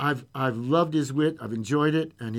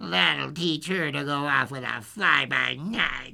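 slow, sneering creature voice; drawn-out mocking character voice; sniggering trees; slower than usual. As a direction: gloat slowly as spiteful trees. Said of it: "heard the trees snigger quietly".

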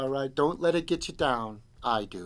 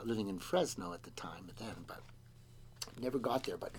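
whisper; 7 semitones lower, 16 dB quieter than usual. Real, not acted.

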